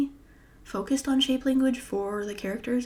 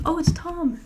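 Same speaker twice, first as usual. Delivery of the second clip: sudden high, loud surprise; surprised; 8 semitones higher, 7 dB louder than usual. Real, not acted. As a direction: react to an unexpected message. Surprised by a message.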